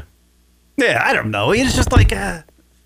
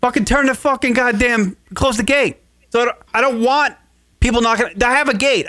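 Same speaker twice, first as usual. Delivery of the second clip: raised, fed-up rant; exasperated rant; 6 semitones higher than usual. Real, not acted. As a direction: vent about the open gate. Ranting about the open gate.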